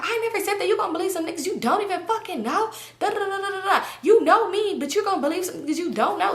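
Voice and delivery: high, whiny mocking of a defensive friend; mocking high whine. Mocking Sabrina denying it to her friend, high whine.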